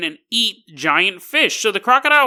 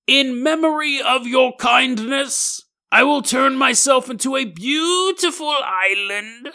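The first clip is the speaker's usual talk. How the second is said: higher, slow and grand, softening at the end; solemn crocodile; 5 semitones higher, slower than usual. Acted. Crocodile's parting promise to the boy, slow solemn voice fading. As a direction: deliver a noble dying vow. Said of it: "This is a quote from the crocodile".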